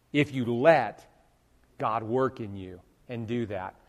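usual speaking voice

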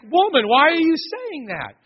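high, slow and loud protest; dismayed husband. Acted. Job answering his wife, high and slow in dismay. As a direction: plead with her, baffled.

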